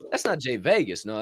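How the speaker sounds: ordinary talk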